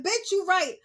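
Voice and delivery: hammed-up blunt agreement; mock emphatic agreement. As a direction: exclaim it high and loud. Repeats imagined reply to mother.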